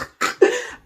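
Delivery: real voiceless burst of laughter; breathy laugh. Real, not acted. Storyteller cracks up at his own quarrel, real laughter.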